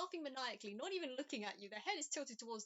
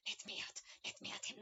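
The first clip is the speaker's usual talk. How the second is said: breathless whisper, straining to get free; whispered threat. Acted. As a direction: hiss it through a barely held rage. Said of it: "you hear Salah say".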